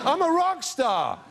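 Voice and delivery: high, slow mock-indignant declaration; indignant boast. Imitating [name] scorning set lists, indignant. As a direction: proclaim it as if insulted.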